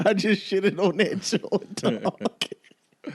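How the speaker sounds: amused at his own burn; chuckling while talking; laughing. Real, not acted.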